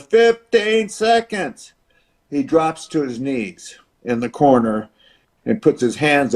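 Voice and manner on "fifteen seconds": drawn-out, higher arena announcer call; announcer calling time. Retold or imitated. Announcer to the crowd, slow higher countdown call. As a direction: stretch it slow like the ring announcer. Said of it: "the announcer goes".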